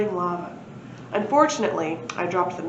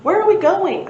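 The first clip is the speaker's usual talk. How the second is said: high, loud urgent query; a startled time traveller; alarmed question; 11 semitones higher, 8 dB louder than usual. Acted. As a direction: ask it in a sudden rush of alarm. Asking as time machine starts.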